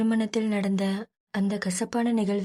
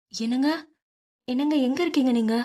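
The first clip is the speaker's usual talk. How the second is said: mild calling out, raised higher; 4 semitones higher, faster than usual. A narrator's reading. Wife hunting for husband through the house, mild calling out. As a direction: call out to your husband across the house, higher. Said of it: "என்று கூச்சலிட்டு".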